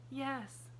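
put-on a girl's high voice, full of pity; pitying little girl. A narrator's reading. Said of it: "pitied Pollyanna".

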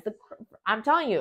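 ordinary delivery

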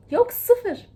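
high loud "Yok, sıfır"; a high, loud, firm no; emphatic dismissal. Real, not acted. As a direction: shoot it down hard.

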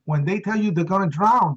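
ordinary delivery

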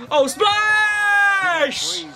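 high, long-held shot call; hyped fan yell as the shot drops; celebratory cry. Real, not acted. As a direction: sing out SPLASH high and stretched.

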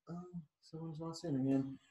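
ordinary delivery

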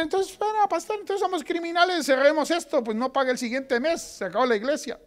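high mock protesting voice; imagined objecting churchgoer. Pastor voicing a churchgoer who objects to him, high mock protest. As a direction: play a scandalised member of the flock. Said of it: "Entonces usted dirá".

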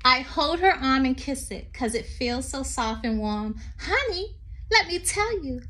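sing-song read-aloud poem voice. Acted. Teacher reading a poem to children, lilting.

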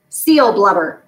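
usual speaking voice

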